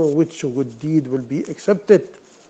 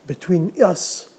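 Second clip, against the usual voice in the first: usual speaking voice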